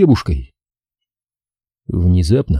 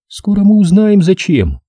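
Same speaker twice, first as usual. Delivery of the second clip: put-on higher girl's voice, teasing promise; 10 semitones higher, 3 dB louder than usual. A narrator's reading. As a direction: lure him with it, lightly voiced young woman. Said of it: "прошептала Кэти".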